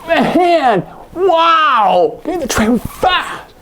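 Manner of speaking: high, excited gasp of amazement; excited wow. Shown as a demonstration. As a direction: marvel at the fast train. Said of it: "do you see where my shoulders are up".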